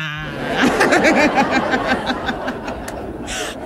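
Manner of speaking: real, drawn-out laughter; long laugh. Real, not acted. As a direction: laugh heartily at your own story. Laughs at end of her story.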